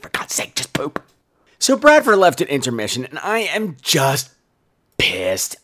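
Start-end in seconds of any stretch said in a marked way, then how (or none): 0.0-1.0 urgent whisper; whispered plea during a show. Retold or imitated. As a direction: hiss it under the breath in the theater. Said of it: "I'm like".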